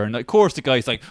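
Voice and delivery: normal talking voice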